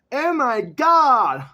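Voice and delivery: drawn-out, high shout, hammed up; loud cry to God. Reading aloud that all people call out in sudden danger, hammed-up shout. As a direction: belt out a theatrical call to heaven.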